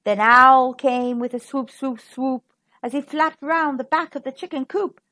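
ordinary delivery

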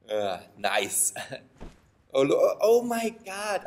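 ordinary delivery

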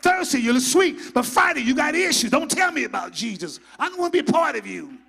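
loud, rising sermon rebuke; preaching at full force. Acted.

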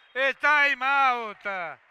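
normal talking voice

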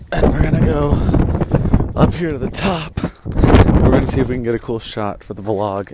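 loud over the wind; shouting over wind. Real, not acted. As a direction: raise the voice above the wind. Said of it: "Sorry about the wind noise".

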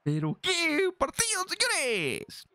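high, slow, stretched-out commentator cry; excited exclamation. Real, not acted. Gamer to viewers after a near goal, drawn-out excited cry. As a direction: draw it out high, letting the pitch drop at the end.

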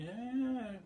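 drawn-out high mock scream; high, stretched-out pretend scream. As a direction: imitate a scream, swooping upward. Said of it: "scream, like".

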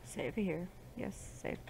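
half-whispered, trailing off into breath; murmured to herself. Real, not acted. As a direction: mutter it to yourself while saving. Murmured to self while saving.

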